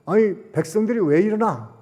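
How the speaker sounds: the king puzzled by his mourning people; surprised king. Acted. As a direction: wonder aloud at the people. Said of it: "그래서".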